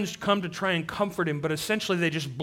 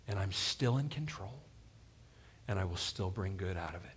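much lower, softer, steady assurance; quiet reassurance; 8 semitones lower, 10 dB quieter than usual. Acted. Preacher voices Jesus promising his frightened disciples control, quiet reassurance. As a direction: drop right down and promise it quietly.

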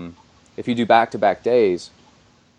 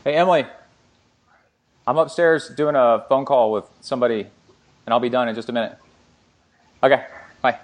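raised, higher calling voice; yelling across the room; 4 semitones higher than usual. Real, not acted. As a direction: call out to someone downstairs. Calls to Emily downstairs.

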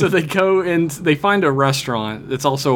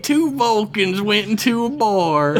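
incredulous Southern guy; 13 semitones higher than usual. Retold or imitated. Voicing Tucker's disbelief.

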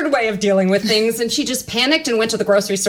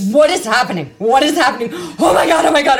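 loud, raised-pitch mock panic, half laughing; retold panicked screaming; 5 semitones higher, 5 dB louder, faster than usual. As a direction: cry out as if in sudden pain. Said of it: "screaming and crying".

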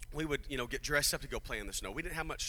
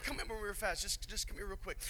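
quiet aside; 5 semitones higher, 5 dB quieter than usual. Real, not acted. Aside calling a helper over.

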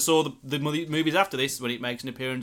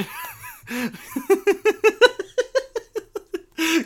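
high laughter; 5 dB louder than usual. Real, not acted. Co-host cracks up.

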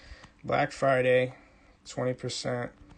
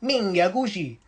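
mock Sicilian; 3 semitones higher than usual. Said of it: "Who says that to somebody".

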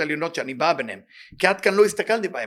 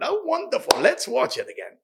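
sarcastic; 10 semitones higher than usual. Acted. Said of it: "sarcastic aside".

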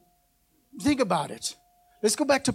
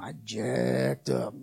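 low, slow murmur to the neighbor; sheepish low I jacked up; told to neighbor; 8 semitones lower, 6 dB quieter, much slower than usual. Shown as a demonstration. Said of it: "tell him".

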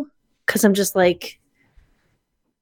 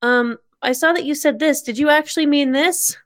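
high, hesitant mock voice of a polite asker; mock timid asker; 6 semitones higher than usual. Friend imitating a hesitant coworker asking politely, high. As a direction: voice the timid person asking, pitched up. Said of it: "of, like".

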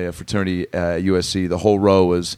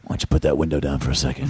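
low, fast, menacing movie-gangster calm; Ray Liotta; 8 semitones lower, faster than usual. Retold or imitated. Movie mobster leaning on a car window, low and calmly menacing. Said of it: "Ray Liotta will come up to a car and be like".

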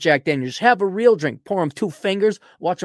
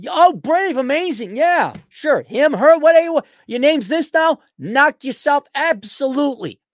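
sarcastic praise; 7 semitones higher, 4 dB louder than usual. Acted.

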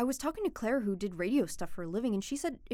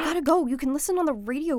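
hurried urgency; 4 semitones higher, 7 dB louder than usual. Acted. Rushing to leave the call.

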